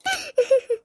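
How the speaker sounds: short, satisfied villain's laugh; a smug little wicked laugh; mother-in-law laughs at her own scheme; evil chuckle. Acted. Villainous laugh after scheming aside.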